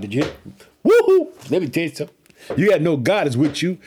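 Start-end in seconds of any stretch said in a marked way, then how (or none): 0.8-1.5 high-pitched excited woo-hoo; real whoop. Real, not acted. Preacher rejoicing over God in the fire, whooping.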